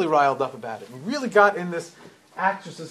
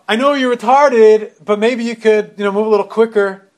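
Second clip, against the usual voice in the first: rude loud jab; 4 semitones higher, 10 dB louder than usual. Retold or imitated. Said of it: "And she's standing there and says".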